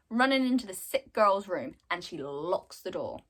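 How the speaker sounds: ordinary talk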